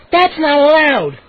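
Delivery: high, slow indignant protest; crying out. A narrator's reading. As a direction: object loudly, stretching the words. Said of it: "Mindy cried".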